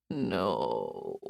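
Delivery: low, weak, muffled moan; sleepy moan. A narrator's reading. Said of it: "he moaned the word".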